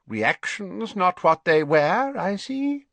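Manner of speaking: put-on high, slow, knowing old man; pointed remark from a plump old wizard; pompous old wizard. A narrator's reading. Slughorn voiced.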